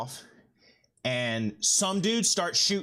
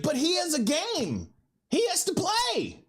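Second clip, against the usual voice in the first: high, whining mock outrage; mad family; 14 semitones higher than usual. Family defending their beloved player, high mock outrage. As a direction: protest indignantly in an imitated high voice. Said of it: "they were all mad at me".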